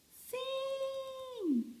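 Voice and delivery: very high, soft little pupil voice; pupil answering. Acted. Teacher playing her pupils answering about the letter V, high pupil voice. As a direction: squeak out a drawn-out pupil's answer.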